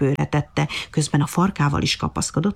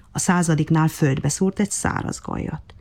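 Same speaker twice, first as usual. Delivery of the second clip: usual speaking voice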